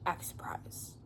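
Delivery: hushed whispered instruction; sneaky director's whisper. Retold or imitated. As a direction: whisper it aside so the cameras miss it. Plutarch instructs family on camera.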